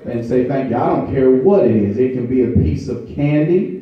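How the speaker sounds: high, loud, rhythmic preaching with held notes; full-force preaching. Acted. Pastor urging the congregation to say thank you, preaching at full force. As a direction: build it up and drive it home to the church.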